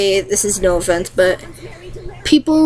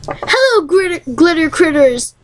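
high, bubbly cheerful greeting; FNAF Land greeting; 6 semitones higher than usual. Retold or imitated. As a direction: squeal the hello up high. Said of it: "My backups account".

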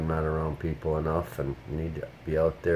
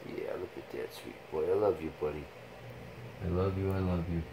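softer, doting murmur to the pet; tender to dog; 9 dB quieter than usual. Real, not acted. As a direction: tell your dog you love him, gently. Cuddling and praising his dog.

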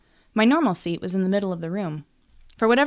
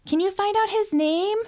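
much higher pleading sister's voice; eager begging; 13 semitones higher, 4 dB louder than usual. A narrator's reading. Sister begging her sister to find out his name, high and eager. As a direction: beg her stepsister like a pushy kid. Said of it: "Diamond begged".

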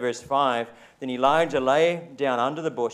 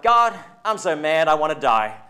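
louder, higher dramatic complaint; mock whining Elijah; 3 semitones higher, 9 dB louder than usual. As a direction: ham up a sulky prophet. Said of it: "Elijah was like".

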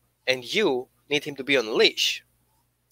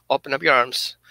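usual speaking voice

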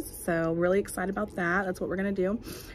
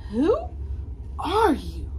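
startled question; 6 semitones higher, 5 dB louder, slower than usual. Acted. Characters confront monster.

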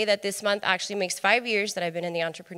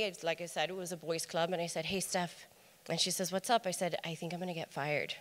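telling her coworker Steph she'll be fired, hushed; hushed confiding; 9 dB quieter than usual. Retold or imitated. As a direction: lean in and drop the voice.

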